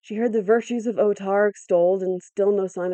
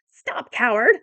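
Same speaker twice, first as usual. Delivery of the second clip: higher, scornful cry; angry cry; 4 semitones higher, 4 dB louder, slower than usual. A narrator's reading. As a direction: cry out at the coward. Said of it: "cried Igos".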